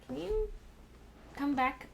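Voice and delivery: high sing-song call; pet-calling voice. Real, not acted. Calling the cat back, high and coaxing.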